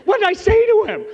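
high and loud, laughing at himself; laughing disbelief. Real, not acted. Laughing at his own blunder.

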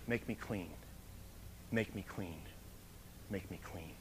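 fading soft plea. Acted. Repeated prayer fading.

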